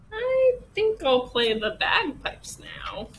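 high, slow duck voice; duck's announcement. A narrator's reading. Duck proposing bagpipes to his friends, high drawn-out announcement. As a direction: draw it out high, sliding down.

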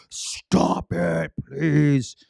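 exasperated plea. Retold or imitated. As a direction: beg them to stop, at the end of your rope. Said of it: "until I'm like".

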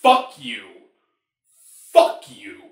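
a short angry snap, then the second one hissed under the breath; retold angry outburst. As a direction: curse at the screen in a real flash of anger. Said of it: "but I still audibly went".

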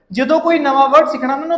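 ordinary talk, nothing marked

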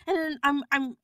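normal talking voice

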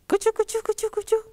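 high-pitched rhythmic chugs; high choo-choo patter; train chugging. Acted. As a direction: imitate a little train rattling past. Imitating the passing train.

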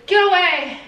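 loud, high mock shout; retold sleep outburst. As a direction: imitate someone yelling in their sleep. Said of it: "And you said".

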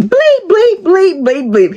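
a fed-up partner spitting out curses; cussing. Retold or imitated. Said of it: "He started cussing".

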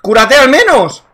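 loud, slow insistent command; urgent shout. Real, not acted. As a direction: yell it at your teammate. Urging teammate to heal.

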